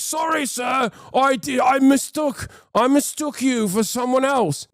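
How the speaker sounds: a guard panics before a noble, high and shouted; groveling guard. Retold or imitated. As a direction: scramble to apologize to your better.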